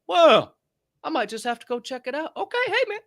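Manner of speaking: high-pitched put-on voice; mock tentative onlooker. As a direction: imitate someone coyly giving in, pitched high. Acts out hesitant interested people.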